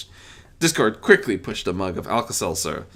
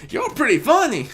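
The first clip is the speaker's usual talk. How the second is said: giggly; 5 dB louder than usual. Acted. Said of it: "burst into a sudden fit of giggles".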